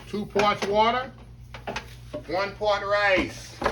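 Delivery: "two parts water, one part rice" spelled out slowly; slow teaching emphasis. Shown as a demonstration. Host drills the rice ratio for viewers, slowly stressed. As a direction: lay out each part like a teacher. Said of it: "Make sure y'all remember that".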